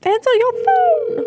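very high, slow mock wail; high-pitched wailing demand. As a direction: stretch it into a squeaky plea. Calling again at a ringing phone.

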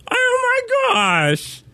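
mock exasperation. Exaggerated dismay at poster.

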